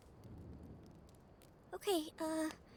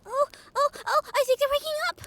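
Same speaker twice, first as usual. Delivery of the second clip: loud, fast, rising excitement; giddy high-pitched rush; excited; 6 semitones higher, 8 dB louder, faster than usual. Acted. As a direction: burst out as they stir. Briar sees the human waking.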